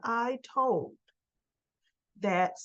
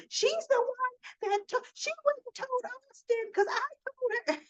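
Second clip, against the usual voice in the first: imitating a frantic, squealed accusation; mock yelling; 14 semitones higher than usual. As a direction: whine the accusation at the top of your pitch. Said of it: "just yelling at Ava".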